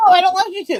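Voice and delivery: a mocking whine; mocking high voice. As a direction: mimic them whining. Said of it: "they're like".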